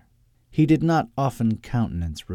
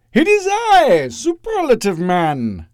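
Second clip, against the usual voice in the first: high, loud, drawn-out heroic proclamation; crying superhero announcement; 13 semitones higher, 8 dB louder, slower than usual. A narrator's reading. Costumed hero bursts through the wall, crying out grandly. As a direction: announce yourself like a comic-book hero. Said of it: "cried the spandex-clad figure".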